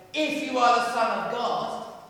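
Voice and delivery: high, loud mocking challenge; devil's taunt. Preacher voicing the devil tempting Jesus, loud mock challenge. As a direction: throw it out as the devil. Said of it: "the voice of a devil saying".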